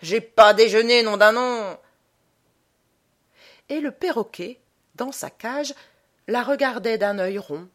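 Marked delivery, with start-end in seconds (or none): 0.0-1.8 very loud, insistent squawked oath; bellowed demand for breakfast; shouted complaint. A narrator's reading. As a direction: cry it out right by the ear.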